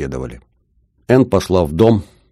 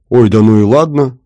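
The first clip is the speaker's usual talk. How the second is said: loud, higher sulky pout; 5 semitones higher, 7 dB louder than usual. A narrator's reading. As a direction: huff it out like a pout. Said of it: "надулась Энн".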